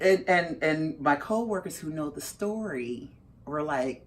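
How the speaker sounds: ordinary delivery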